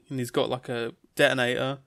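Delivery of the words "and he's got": plain reading voice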